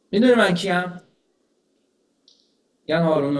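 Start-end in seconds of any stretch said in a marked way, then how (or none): 0.1-1.0 loud boast. Retold or imitated. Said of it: "با صدای بلند به قومش گفت".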